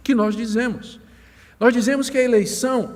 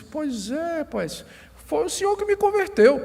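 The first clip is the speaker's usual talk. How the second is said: put-on drunk; 6 semitones higher, 3 dB quieter than usual. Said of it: "eu não sei imitar um bêbado".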